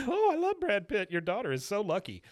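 very high, loud swooning gush; gushing Luann. Retold or imitated. Host voicing Luann gushing over Brad Pitt, high squeal. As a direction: squeal it like a starstruck fan.

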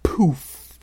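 airy imitated poof; poof sound effect. A narrator's reading. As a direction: puff the word out like a burst of smoke. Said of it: "There was a loud".

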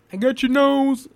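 put-on high playful squeal of a kid's joke; silly got-your-nose. Host teasing listeners with a kid's trick, high playful squeal. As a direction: tease the listener like stealing a child's nose.

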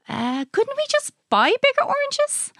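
put-on high, doubtful child's voice; sceptical child. A narrator's reading. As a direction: question it high and hesitantly.

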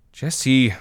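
a high, pleading protest of his name. Acted. Hannah protesting to Jesse about the dead horses, high protest. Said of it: "Hannah protested".

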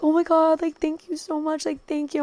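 high, softened gush of thanks; sweet high-pitched retold thank-you. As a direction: imitate flattered people gushing. Acts out TikTokers thanking her.

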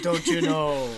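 put-on a light Minnesota accent on "don't you know". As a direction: mimic a Minnesotan. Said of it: "cool Minnesota accent".

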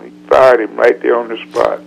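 ordinary talk

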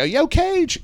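high-pitched hail to a buddy; retold call-out. Retold greeting across the bar.

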